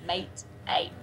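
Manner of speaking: "Mate" said with a wide Australian A sound; Aussie vowel in mate. Shown as a demonstration. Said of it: "the A vowel".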